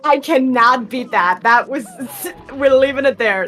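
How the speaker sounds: laughing out loud at himself; words squeezed through laughter. Real, not acted. Laughing after own impression.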